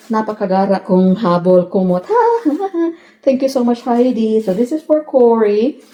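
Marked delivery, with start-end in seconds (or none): 2.1-2.9 short laugh. Real, not acted. Laughs at fancy blanket.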